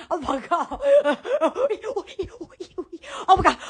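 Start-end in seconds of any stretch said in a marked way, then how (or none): none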